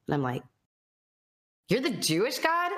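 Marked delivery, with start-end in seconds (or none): 1.7-2.7 higher, disbelieving question; shocked question. Retold or imitated. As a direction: ask it like you can't believe it. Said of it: "I was so shocked".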